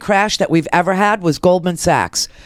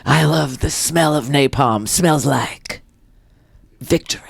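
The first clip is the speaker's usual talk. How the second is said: Colonel Kilgore; 7 semitones lower than usual. Retold or imitated. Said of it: "now he sounds like Colonel Kilgore".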